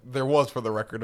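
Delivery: ordinary talk, nothing marked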